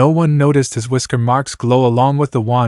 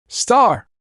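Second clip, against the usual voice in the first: high shout of her name; 12 semitones higher than usual. A narrator's reading. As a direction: call out to her in alarm. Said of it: "he shouted".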